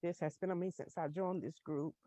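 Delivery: fighting tears, barely above a murmur; real emotion, trying not to cry; holding back tears. Real, not acted. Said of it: "I'm trying not to cry".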